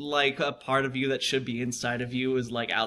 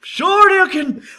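high, slow, loud special move yell; Street Fighter shout; 11 dB louder, slower than usual. Retold or imitated. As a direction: call the move like Ryu.

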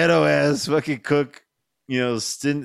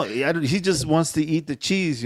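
normal talking voice

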